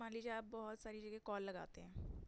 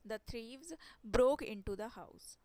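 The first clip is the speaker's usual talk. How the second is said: slow and loud, every word clear; slow emphatic example; 11 dB louder, much slower than usual. Shown as a demonstration. Teacher to class, slow emphatic model. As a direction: model the sentence for learners. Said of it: "teacher models example sentence".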